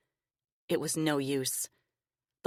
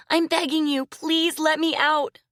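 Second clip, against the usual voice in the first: put-on begging girl; 12 semitones higher, 4 dB louder than usual. A narrator's reading. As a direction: beg to be let out. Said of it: "Lily cried".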